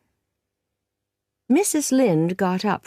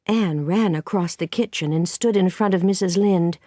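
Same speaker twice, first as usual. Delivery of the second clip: ordinary delivery, nothing marked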